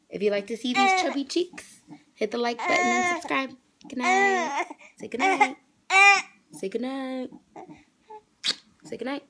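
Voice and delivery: put-on high sing-song baby talk. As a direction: coo it to the baby. Speaking for baby to viewers.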